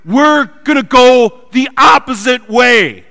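high, loud, slow shout; yelled over storm. Retold or imitated. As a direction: yell it over the wind. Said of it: "they yell".

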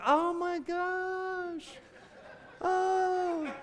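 high, slow, breathless surprise, a little laugh; fiancée's gasp. Retold or imitated. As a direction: play the overwhelmed bride-to-be. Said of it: "she's like".

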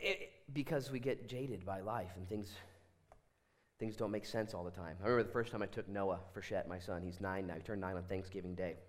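normal talking voice

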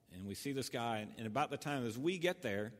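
normal talking voice

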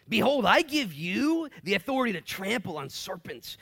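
commanding proclamation. Acted. Voicing Jesus with authority.

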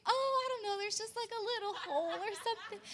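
high, fast innocent voice; mock innocent brushing off; innocent downplaying. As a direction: play it down sweetly, quick and high. Downplaying the damage quoted.

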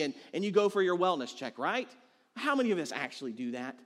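ordinary delivery

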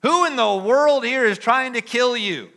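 high, loud, outraged disbelief; indignant crowd shout. Acted. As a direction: yell it back as the angry mob. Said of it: "The crowd is saying".

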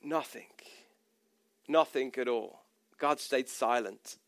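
low, quiet, spaced out for effect; hushed. Real, not acted. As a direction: drop to a grave hush after the build-up. Dramatic answer: God said nothing.

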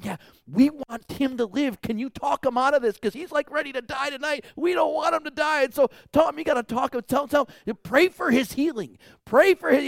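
high, loud pleading relatives; pleading family. Retold or imitated. Family begging the pastor, high and loud. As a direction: beg the pastor to talk him out of it. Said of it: "I got the family going".

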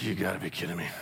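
low, very soft mock exasperation; acted weary dad muttering under his breath; muttered disbelief. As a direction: drop to a low, dismayed undertone. Dad reacts to child's late request.

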